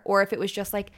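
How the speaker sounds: ordinary talk, nothing marked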